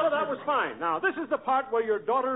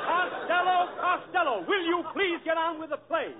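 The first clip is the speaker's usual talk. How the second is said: exasperated shout; 7 semitones higher than usual. Real, not acted. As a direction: yell to get him back on track. Director yells at Costello.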